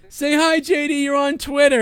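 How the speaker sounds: high vlogger chirp; perky camera-host voice; mock Tommy filming. Tommy filming J D for Twitter, high perky mimic. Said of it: "he's like".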